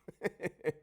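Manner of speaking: short high laugh; a hard little laugh at death; callous laugh. Real, not acted. Laughing off the dead, calloused and high. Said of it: "That's me being calloused".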